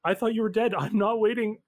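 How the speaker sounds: stammer, then insist as an upset mother; a lightly acted flustered mom; stunned mother. Plays the mother on phone.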